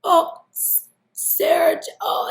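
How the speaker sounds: high, loud excited stammer of a name; imitating an old friend overjoyed to see her. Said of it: "he's doing this".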